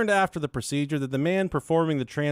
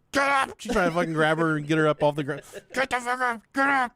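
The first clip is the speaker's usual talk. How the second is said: mock yelling mom; 7 semitones higher, faster than usual. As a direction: bark get up at the kid on the floor. Mom yelling at crying child.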